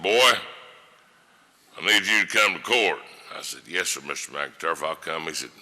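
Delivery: ordinary delivery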